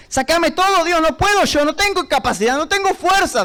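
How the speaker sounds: retold desperate prayer, pitched high; urgent plea from a broken man; broken, tearful pleading. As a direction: beg God in anguish. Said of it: "Y estaba llorando, quebrantado".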